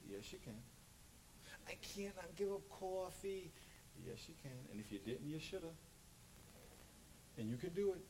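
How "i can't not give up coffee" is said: whiny excuse. Pretend. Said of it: "mock excuse".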